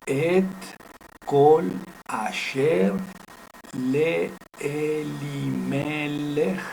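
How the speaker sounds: drawn-out syllables with sustained vowels; careful, stretched-out demonstration reading; slow Hebrew reading. Shown as a demonstration. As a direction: read it out for learners, holding each syllable. Reads Hebrew verse syllable by syllable.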